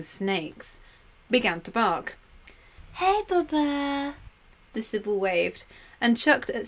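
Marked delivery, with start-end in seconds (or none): 2.9-4.1 dog talk. Acted. Sibyl greeting the guard dog.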